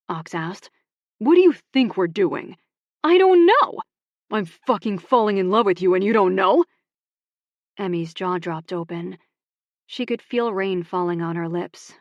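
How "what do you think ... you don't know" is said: much louder, higher, shouting; heated shout. Acted. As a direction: yell the confession in anger. Heated shouted love confession.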